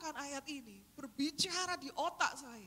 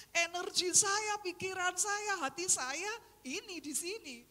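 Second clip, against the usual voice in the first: usual speaking voice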